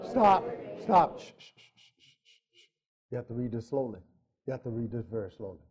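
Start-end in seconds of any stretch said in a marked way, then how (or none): 1.2-2.6 soft repeated shushes; hushing shh. Pretend. Hushing before the verse is read. 3.1-5.6 very soft and low; hushed instruction. Pretend. Hushed instruction after shushing the room.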